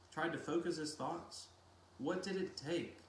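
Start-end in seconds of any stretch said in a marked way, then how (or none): none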